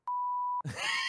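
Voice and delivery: squealing unvoiced laugh; high breathless cackle. Brother laughing at the kid's gold. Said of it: "he's like".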